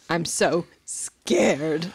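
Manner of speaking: drawn-out slow, tearful line; slow mock sob. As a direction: drag it out, breaking down. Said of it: "breaks down into tears".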